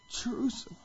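voiceless, grieving whisper; weeping lament. Retold or imitated. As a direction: let the name fade into a whisper. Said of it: "falls to his knees and he weeps".

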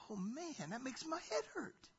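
soft, rising mock bewilderment; hushed "mind blown" reaction, climbing high; baffled listener. Voicing a baffled listener of Jesus.

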